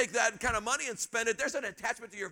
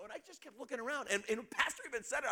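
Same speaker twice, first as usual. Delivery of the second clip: ordinary talk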